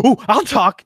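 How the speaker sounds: high, loud mock-eager pipe-up; eager volunteering. Game master imitating a character volunteering to talk, eager. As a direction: jump in with exaggerated eagerness.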